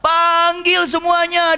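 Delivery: very high summoning call; urgent call. Retold or imitated. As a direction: call Abbas over the din. Prophet calling Abbas in battle.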